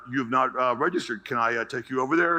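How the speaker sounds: lightly voiced guard, low and courteous; a hint of an obliging guard; polite security guard. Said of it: "Extremely polite".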